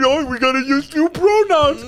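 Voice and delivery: very high, loud mocking squawk; hammed-up high-pitched mockery; mocking imitation. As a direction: mimic the podcast crew in a loud, high caricature. Continues mocking the podcast hosts.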